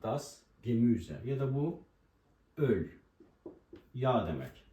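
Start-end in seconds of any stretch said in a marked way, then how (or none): 0.0-1.2 Das, Gemüse spelled out slowly, each word apart; slow German demo. Shown as a demonstration. Teacher spells out Das, Gemüse slowly for learners. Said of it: "Artikeli das'tır".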